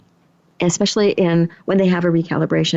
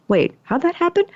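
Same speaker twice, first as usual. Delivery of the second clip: much higher, surprised double-take; pleasantly puzzled; 10 semitones higher than usual. Acted. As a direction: act out a sudden puzzled realisation. Acts out sudden puzzled realisation.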